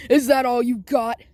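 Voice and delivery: laugh, then taunt loudly as the ogre. Acted. Ogre mocks Deku's punches, loud laughing taunt. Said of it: "the ogre's like".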